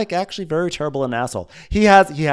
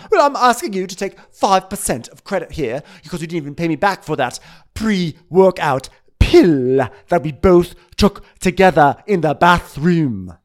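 loud, higher-pitched indignant rant; hyped-up ranting; 5 semitones higher, 7 dB louder than usual. Retold or imitated. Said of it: "You're getting hyper".

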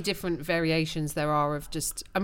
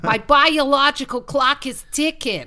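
put-on higher Jersey accent, playful; Marissa Tomei; 6 semitones higher, 5 dB louder than usual. Quoting a favorite Cousin Vinny line in a Jersey accent. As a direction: channel Cousin Vinny's Jersey girlfriend, up high. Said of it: "Marissa Tomei in My Cousin Vinny".

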